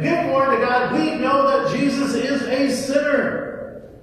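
high, loud accusing demand; loud accusing Pharisee; stern Pharisee shout. Acted. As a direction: bark the charge at him. Pharisees confront the healed man.

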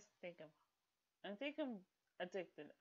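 ordinary talk

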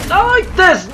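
screaming it high and loud in panic; desperate scream. Real, not acted. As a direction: yell in real despair as the fight slips away. Losing a boss fight, screaming.

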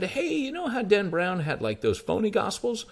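hammed-up excited woman with a brand-new idea; mock eureka excitement. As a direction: imitate her as if she found something radical. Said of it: "kinda acting like she had just discovered the most punk rock concept".

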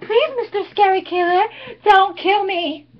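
sarcastic pleading, slowed on don't kill me; mock begging. Cheerleader mockingly begs the killer for her life. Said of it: "supposed to beg for my life".

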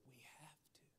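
voiceless, very soft aside; urgent whisper. Retold or imitated. Husband answering his wife's objection at the table, whispered. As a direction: whisper it privately across the table. Urging wife in private at table.

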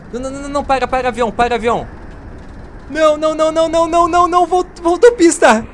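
high, loud frantic shouting; panicked yell at the plane. Real, not acted. Plane overshoots runway.

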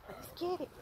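muffled hype-up behind a mask; rallying words through a face mask. Real, not acted. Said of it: "can't understand me with the mask on".

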